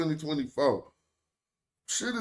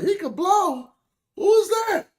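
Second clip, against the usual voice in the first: high mock bystander; 7 dB louder than usual. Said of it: "People was walking up like".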